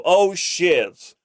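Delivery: usual speaking voice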